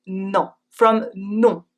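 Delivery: "non" said slowly and clearly; careful teacher non; slow demo of non. Shown as a demonstration. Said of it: "counterpart of ouais is non".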